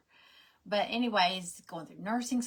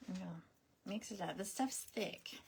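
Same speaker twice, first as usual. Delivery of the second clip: normal talking voice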